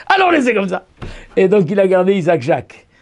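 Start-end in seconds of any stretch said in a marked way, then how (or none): 0.1-0.9 higher, savoring "Alors laissez comme ça"; amused repeat of the father's sly punchline. Real, not acted. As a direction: relish the punchline after laughing. Laughs then repeats.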